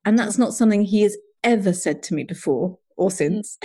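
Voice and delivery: ordinary talk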